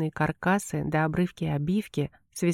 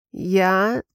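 voice breaking; 5 dB louder than usual. A narrator's reading. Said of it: "Мой голос сорвался".

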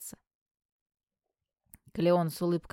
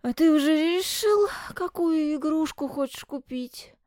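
put-on sleepy child; 10 semitones higher, 5 dB louder than usual. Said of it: "спросил всё ещё сонный Павел".

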